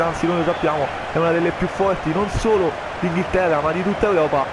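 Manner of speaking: ordinary delivery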